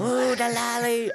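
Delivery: high, slow mystic crooning; sing-song like a carnival psychic; fortune teller. Pretend.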